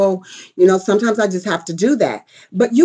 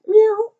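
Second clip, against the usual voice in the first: high playful meow; mock cat meow, pitched high; 11 semitones higher than usual.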